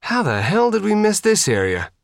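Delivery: speaking through something muffled. A narrator's reading. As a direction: voice muffled, high and tense. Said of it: "in a muffled voice".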